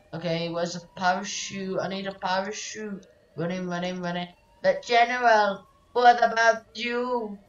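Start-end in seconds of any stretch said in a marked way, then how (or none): 4.6-7.4 raised, louder plea to the General; dramatic concern. Acted. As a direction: call out in acted worry.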